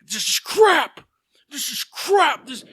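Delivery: loud, raging rant at the food; angry outburst. Retold or imitated. Colonel Sanders impersonator yelling at restaurant staff, furious shout. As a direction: bellow it like a furious old man spitting out bad chicken. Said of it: "I'm actually churching it up".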